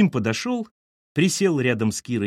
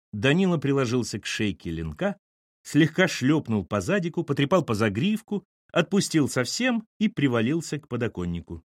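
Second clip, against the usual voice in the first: ordinary delivery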